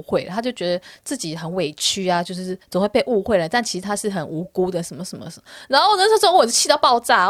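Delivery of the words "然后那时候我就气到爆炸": angry. Real, not acted.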